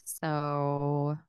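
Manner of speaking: slow deadpan podcast interview voice; unemotional interviewer. Acted. Co-host imitates girl interviewing [name] in bed, unemotional. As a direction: ask it slowly, drained of all emotion. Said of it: "I need to talk like this ... unemotional".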